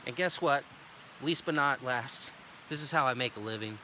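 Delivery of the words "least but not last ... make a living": real serious. Shown as a demonstration. Salesman turns serious telling homeowners how he earns a living. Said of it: "Notice I changed my voice. I get real serious".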